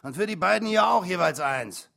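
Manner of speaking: boozy, high-pitched bellow; drunken shout. A narrator's reading. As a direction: yell the order out drunkenly. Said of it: "rief einer von ihnen besoffen".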